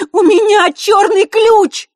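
высокий громкий медленный крик; выкрикни изо всех сил. A narrator's reading. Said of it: "выкрикнула изо всех сил". (крик изо всех сил)

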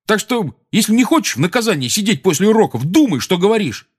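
high-pitched stern threat; heated warning. A narrator's reading. Ron threatening Seamus with detention, heated warning.

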